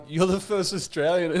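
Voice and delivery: high, mock happy-tearful cry; overjoyed imitation of a homesick expat. Skydive instructor greeting a fellow Australian, crying happy. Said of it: "crying happy".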